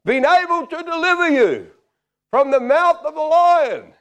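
high, loud, drawn-out royal cry; king calling out. Acted. King calls down to Daniel, hammed-up shout. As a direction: holler slowly down into the lions' den. King's call to Daniel continues.

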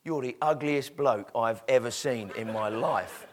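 low, slow, measured put-down; deadpan insult. Acted. Parrot insults the customer who came over, deadpan. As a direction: drop the pitch and spell out the insult.

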